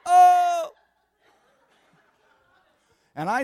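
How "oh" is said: loud, high swooning oh; hammed-up cry of someone overcome; overwhelmed mock cry. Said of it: "she throws her body over the counter".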